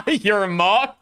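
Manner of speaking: high, incredulous question; amused disbelief. Real, not acted. Streamer reacting to what she read, high incredulous question. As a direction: repeat it in high-pitched disbelief. Incredulous repeat after laughing.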